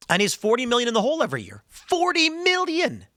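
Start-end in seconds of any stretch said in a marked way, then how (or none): 1.7-3.0 high, drawn-out disbelief; incredulous repeat. Real, not acted. Host marvelling at a show's losses, incredulous.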